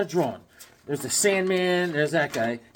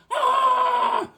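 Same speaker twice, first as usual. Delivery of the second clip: very high, held cartoon shriek; mock high scream; 3 dB louder than usual. Cartoon fighter mid-attack, high mock scream. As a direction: scream on one long high note.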